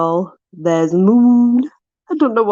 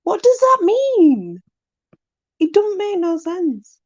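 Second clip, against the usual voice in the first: much higher, slow, exasperated question; exasperated confusion; 9 semitones higher than usual. Real, not acted. Vlogger exasperated at a saying, high and slow. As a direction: throw up your hands, totally lost.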